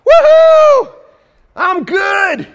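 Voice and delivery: very high, very loud whoop; mock victory whoop. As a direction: cheer as if you just won. Mocking ruler's self-congratulation.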